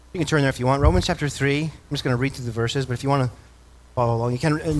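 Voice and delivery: ordinary delivery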